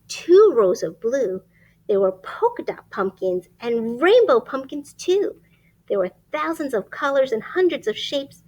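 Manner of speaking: lively rhyming storybook read; reading to children. Acted. Reading a rhyming picture book aloud to children. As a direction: read it like bedtime story time.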